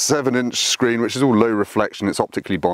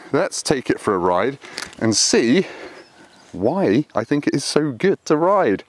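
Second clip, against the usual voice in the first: ordinary delivery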